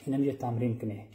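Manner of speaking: ordinary talk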